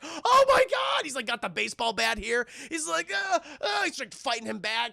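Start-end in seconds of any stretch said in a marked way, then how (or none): 0.0-1.0 high, loud, stretched-out alarm; mock panicked cry. Streamer as Billy attacked with a chainsaw, mock panic. 3.1-3.8 high, effortful "uh" grunts; imitated struggling grunts. Streamer as Billy fighting Stripe back, mock strained grunts.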